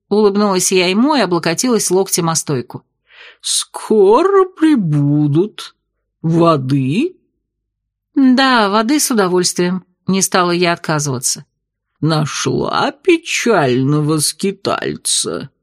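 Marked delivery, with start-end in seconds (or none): 3.4-7.2 slow, strange low alien voice; gurgling tentacle alien. Acted. Octopus bartender speaks. 12.0-15.5 slow low alien voice; gurgling tentacle alien. Acted. Octopus bartender speaks.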